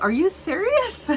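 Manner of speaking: high incredulous question; disbelieving. Retold or imitated. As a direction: pitch it way up, can't believe it. Disbelief at coworker.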